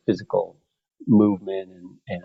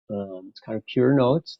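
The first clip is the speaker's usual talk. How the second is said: usual speaking voice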